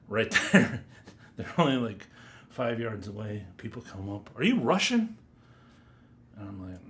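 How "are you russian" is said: high, loud astonished question; mock-amazed, voice jumping high; retold astonished question. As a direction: imitate strangers asking, pitched up and loud. Retold question from surprised strangers.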